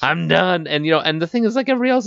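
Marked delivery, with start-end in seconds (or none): 0.0-0.7 burnt-throat voice. Retold or imitated. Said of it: "I'm gonna talk like this".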